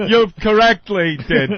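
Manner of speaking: mock-nervous, tongue-tied delivery; nervous fumbling. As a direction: flub the tough line with nerves. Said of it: "I might be shaking".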